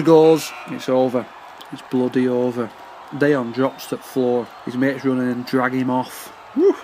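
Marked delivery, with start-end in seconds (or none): none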